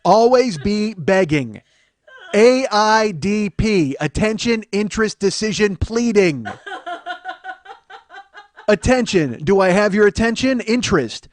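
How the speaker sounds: loud, hammered-out sales tirade; hard-sell sales boss. Acted. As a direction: belligerent motivational speech, each slogan punched out.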